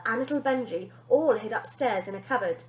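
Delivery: ordinary delivery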